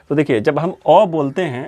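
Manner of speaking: usual speaking voice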